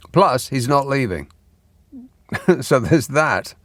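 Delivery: ordinary talk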